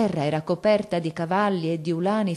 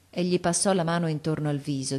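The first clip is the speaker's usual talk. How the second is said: ordinary delivery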